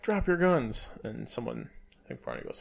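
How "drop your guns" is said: mock command. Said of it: "they're like".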